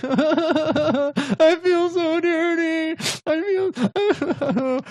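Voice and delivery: very high, drawn-out fake sobbing wail; mock weeping. Imagining uncontrollable weeping after sex, high mock crying. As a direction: bawl in hammed-up misery. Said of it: "I just start weeping uncontrollably".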